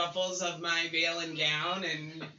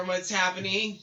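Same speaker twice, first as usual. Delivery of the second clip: ordinary talk, nothing marked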